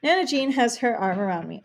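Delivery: usual speaking voice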